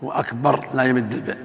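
ordinary talk, nothing marked

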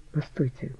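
very soft, hushed plea to wait; faint, halting murmur; whispered interjection. A narrator's reading. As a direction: barely voice it, almost a whisper. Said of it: "прошептала Анжелика".

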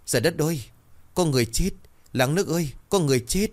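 high, frantic yelling for the village; panicked cry for help. A narrator's reading. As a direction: scream for help in terror. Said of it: "vừa bò vừa la làng". (hoảng hốt la làng kêu cứu)

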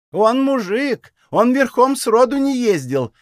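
high, slow, squeaky jeering voice; mock thin voice. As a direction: play the fool in a fake thin voice. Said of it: "юродствуя притворно тонким голосом".

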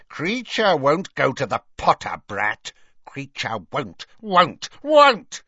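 defiant elf croak, climbing at the end; croaking house elf. A narrator's reading. House elf refusing to go to Potter, defiant croaking. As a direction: croak stubbornly like a fretful elf. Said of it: "Creature's continued croaks".

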